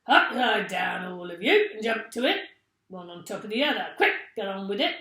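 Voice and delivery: loud, barked orders; fearsome monkey trainer. A narrator's reading. Mister Twit ordering the monkeys to stack up, loud. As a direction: drive them like a circus trainer. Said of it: "fearsome monkey trainer's voice".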